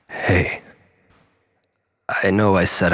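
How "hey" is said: whispered hey. Acted. Tentative approach to Lisa.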